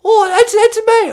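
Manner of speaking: put-on very high, loud posh British old lady; squeaky mock Queen Mum; Queen of England. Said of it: "It's the Queen Mum".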